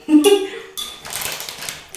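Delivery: high breath-filled giggle; high, airy laugh; real giggle. Real, not acted. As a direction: giggle mostly on air. Laughs after admitting it.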